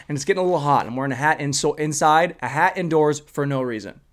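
ordinary talk, nothing marked

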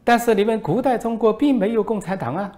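high, put-on voice of a foreign reporter; mocking reporter voice. Foreign reporter needles the minister, high mocking voice. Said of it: "外国记者说".

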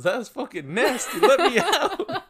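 half-laughing demand to escape; disgusted outburst. Retold or imitated. Drummer in the back seat loudly begs to get out.

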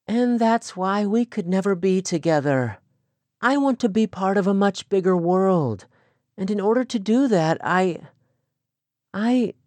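put-on girl struggling to say it; lightly voiced young woman. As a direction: press her point, then stumble. Rachel explains, falters.

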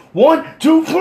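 high count building to a long shout; heaving count. Retold or imitated. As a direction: count off, then roar on the lift. Counting while heaving man up.

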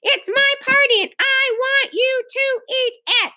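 high, emphatic tantrum voice; angry demand. A narrator's reading. Hostess demands that guest eat, angrily insistent. Furious child insisting at party.

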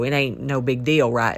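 usual speaking voice

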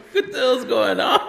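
high, laughing put-on shock; mock shock. Walking in on the mess, high laughing shock. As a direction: squeak it in disbelief. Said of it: "I'm like".

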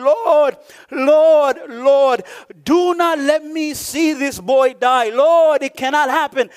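acted desperate prayer, pleading without tears; crying out desperately. Preacher voices mother pleading for her son's life, crying out. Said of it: "She's crying. She's saying".

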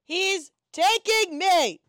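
high, drawn-out fake distress; mock cry for help. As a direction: wail it like a captive. Prisoner bit, crying out.

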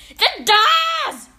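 booming high-pitched witch's shout; loud, commanding screech; Grand High Witch. A narrator's reading. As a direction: shout it to fill the whole room. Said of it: "shouted the Grand High Witch in a voice that filled the room".

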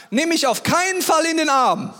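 high, loud plea; mock emphatic warning. Shown as a demonstration. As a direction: warn it off loudly, pitched right up.

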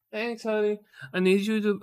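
high sweet-talking request; imitated wife. Wife asking husband at the shelf, high imitation.

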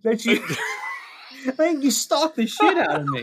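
high, breaking into big laughs; laughing hard. Real, not acted. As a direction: talk through helpless laughter. Creeped out by the burger photo.

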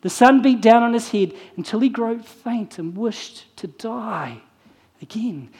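ordinary delivery

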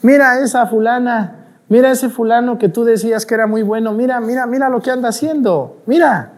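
high-pitched gloating devil tattling to God; taunting devil. Acted. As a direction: jeer like an accuser pointing out sinners. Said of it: "Para que el diablo ... voltear a Dios y decirle".

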